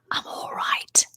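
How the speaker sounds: soft, voiceless whisper. Retold or imitated. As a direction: imitate dad's hushed whisper. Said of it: "my dad say in a whisper".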